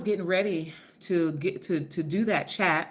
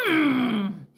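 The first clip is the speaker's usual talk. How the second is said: savoring mm; 6 semitones higher, 4 dB louder than usual. Real, not acted. Savoring viewer's dish.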